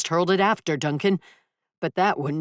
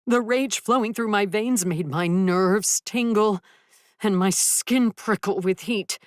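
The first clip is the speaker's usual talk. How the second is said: ordinary delivery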